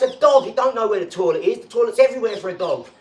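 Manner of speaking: loud, high exasperated shout. Retold or imitated. Snapping at his cousin about the dog mess, loud shout. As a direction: yell it at the cousin in disbelief. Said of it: "I'm like".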